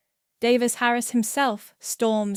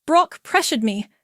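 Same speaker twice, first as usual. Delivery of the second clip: high, loud, distraught confession; tearful; 6 semitones higher, 7 dB louder, faster than usual. A narrator's reading. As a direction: blurt it out as if bursting into tears. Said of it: "Elise suddenly bursts into tears".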